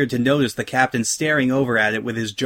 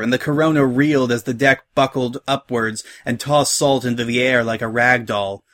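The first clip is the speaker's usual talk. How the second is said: ordinary delivery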